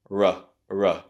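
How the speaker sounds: r as "ruh", twice; r plus a short neutral uh; schwa sound. Shown as a demonstration. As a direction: relax r into an English "ruh". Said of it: "or the schwa sound uh".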